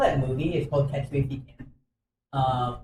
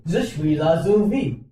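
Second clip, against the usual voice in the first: slow, laughing fake French accent; mock French accent; 4 dB louder than usual. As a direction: play a silly French accent, giggling. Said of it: "we speak in French now".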